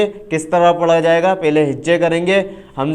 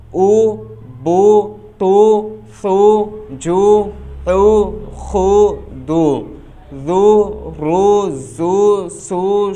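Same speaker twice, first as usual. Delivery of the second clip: sing-song recitation; much slower than usual. Shown as a demonstration. Teacher chanting pesh syllables fluently for students. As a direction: chant each syllable like a class reciting together.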